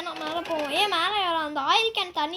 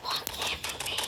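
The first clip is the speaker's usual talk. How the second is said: voiceless, hushed whisper; obedient whisper; 9 dB quieter, much slower than usual. Real, not acted. Child answering mother who asked for quiet, whispering. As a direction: whisper it back very softly.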